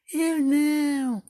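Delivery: high, slow kitty refusal; a lazy cat saying no, drawn out; little cat voice. Acted. The cat answers the hen, in a high slow kitty voice.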